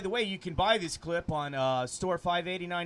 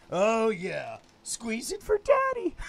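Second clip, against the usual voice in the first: sleazy high voice; 4 semitones higher, 5 dB louder, slower than usual. Acted. Acts out a lewd role.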